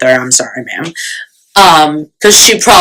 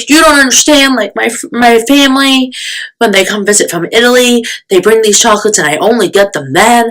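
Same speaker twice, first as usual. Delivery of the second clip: higher, quick mock pleading; begging friend; 7 semitones higher than usual. Friend arguing for the family's chocolates, imitated begging. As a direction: imitate a friend pleading her case, pitched up. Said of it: "She's begging me".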